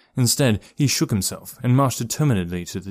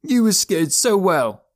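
high, slow, gloating taunt; mirthful boy's taunt; gleeful teasing; 10 semitones higher, 4 dB louder, slower than usual. A narrator's reading. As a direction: draw it out high, crowing. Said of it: "still hooting with mirth".

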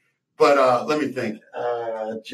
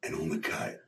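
much lower, slow, near-whispered intense pitch; Gary Busey impression; 8 semitones lower, 10 dB quieter than usual. Busey pitching Omaha Steaks to the owner in a low hush. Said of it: "God, I haven't done this impression".

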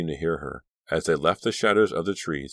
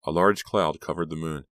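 ordinary delivery

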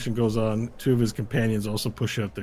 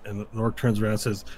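usual speaking voice

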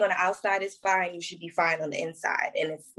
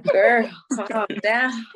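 teasing scold; 3 semitones higher, 5 dB louder, much slower than usual. Pretend. Advice to younger self.

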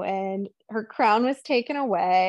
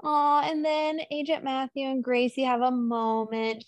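ordinary talk

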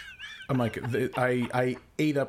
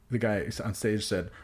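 ordinary talk

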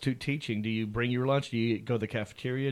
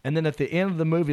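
normal talking voice

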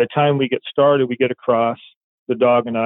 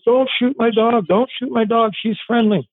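pleading; 11 semitones higher than usual. Retold or imitated. Suspect begging the officers to spare his dog. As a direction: beg them not to shoot.

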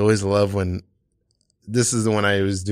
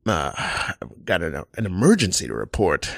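Johnny Carson; 5 semitones lower, 3 dB quieter than usual. Retold or imitated. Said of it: "this is Johnny Carson making the nine one one call".

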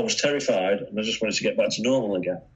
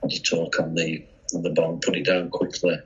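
usual speaking voice

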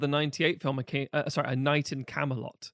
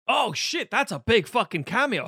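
high, slow mock amazement; excited surprise; 7 semitones higher, 6 dB louder, slower than usual. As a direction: gasp it out like spotting a big cameo. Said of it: "And it was like".

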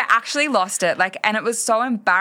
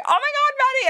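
much higher, gushing oh my God; faster than usual. Retold or imitated. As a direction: squeal it like a friend after a great date. Friend gushing about her date.

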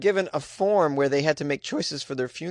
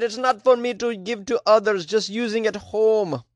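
put-on Jamaican accent; 6 semitones higher than usual. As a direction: quote him in a Jamaican accent. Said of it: "How's that Jamaican accent?".

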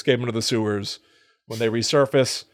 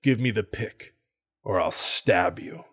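lower, slow, half-acted threat; menacing villain threat; 4 semitones lower than usual. Retelling a movie villain demanding the pick, lower and slower. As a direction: lean in low and slow like a cornered villain. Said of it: "he says".

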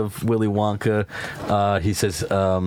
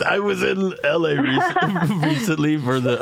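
laughing; 6 semitones higher than usual. Real, not acted. Laughing while speaking.

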